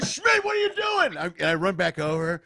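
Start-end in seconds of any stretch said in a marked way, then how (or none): 0.0-1.2 high, loud, slow coach yell. Retold or imitated. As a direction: shout across the field at a lost player. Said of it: "the coach is going".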